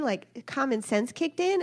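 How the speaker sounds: ordinary talk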